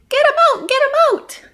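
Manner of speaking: high, loud, frantic yelling; panicked scream. Acted. Roxy screaming for help with spines in her nose. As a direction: beg in panic. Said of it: "screamed Roxy".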